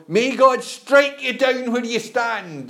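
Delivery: a shrill-free but hammed-up old wifey, high and slow; scolding old wifey. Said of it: "the old wifey".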